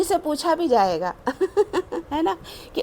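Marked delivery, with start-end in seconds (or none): 1.2-2.1 a quick amused chuckle; short laugh. Real, not acted. Laughs at the listeners' habit. 2.1-2.8 laughing. Real, not acted. Talks through amusement.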